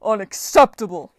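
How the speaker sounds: loud booming giant's roar; a giant bellowing at the goddess's betrayal. Acted. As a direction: bellow it in outrage. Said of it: "the giant bellowed".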